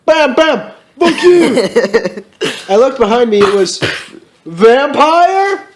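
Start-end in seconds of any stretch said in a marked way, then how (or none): 0.0-2.2 high, mock-angry gunfire shouts; angry shooting. A narrator's reading. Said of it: "someone shooting angrily". 4.4-5.7 high, hammed-up shock; dramatic reveal.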